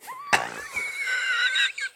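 squealing high laugh; high burst of laughter. Real, not acted. Laughing at own joke.

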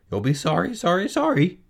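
high warning squawk; high, scolding bird voice; goose calling. Acted. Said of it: "called the goose".

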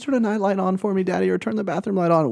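put-on high, pleading child voice; a hint of a begging kid. Kids begging for night light.

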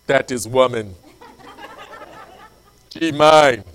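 loud, halting caveman grunt-talk; mock caveman. Man smitten with his future wife, mock caveman. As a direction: claim her like a caveman, weighty pauses.

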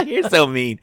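laughing playful protest; giggling through the words. Real, not acted. Teasing back while laughing.

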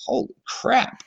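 breathy, high, hushed amazement; whispered disbelief; stunned whisper. Retold or imitated. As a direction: gasp it out, unable to believe it. Hearing prize money amount.

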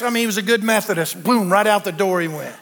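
ordinary talk, nothing marked

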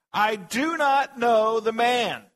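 loud, high, drawn-out shout; cursing, swearing denial. A narrator's reading. Peter swearing to the bystanders, a shouted denial. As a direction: swear it at full force, word by word. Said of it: "began to invoke a curse on himself and to swear".

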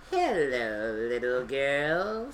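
low, drawn-out wolf growl; deep, growly voice. A narrator's reading. Wolf greeting a little girl in the forest, deep and growly. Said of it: "said a deep, growly voice".

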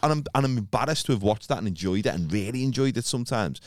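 ordinary talk

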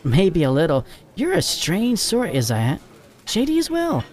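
high, light female character voice; laughing it off. Acted. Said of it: "Jack laughed it off".

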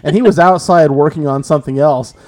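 ordinary talk, nothing marked

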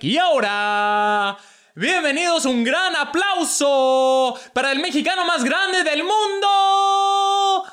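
soaring, drawn-out ring announcer hype in Spanish; fight announcer. Retold or imitated. Hyping the biggest Mexican to a crowd, announcer voice. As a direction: boom it out like a soccer broadcaster after a goal. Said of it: "pretend I was like a, like a fight announcer or".

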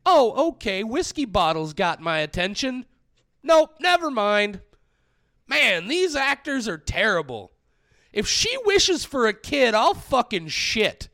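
loud, high exasperated rant; reading notes with outrage. Shown as a demonstration. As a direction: read the notes like live reactions. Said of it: "goes something like this".

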